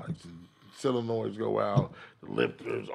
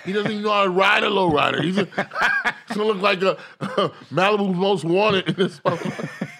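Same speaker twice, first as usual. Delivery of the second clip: laughing, higher and louder; mock him through laughter; 6 semitones higher, 4 dB louder than usual. Real, not acted. Laughing at friend's car.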